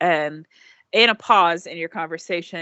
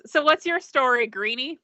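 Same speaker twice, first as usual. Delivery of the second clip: higher, teasing question; character voice; 7 semitones higher than usual. Acted. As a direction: casually probe the newcomer. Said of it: "and she goes".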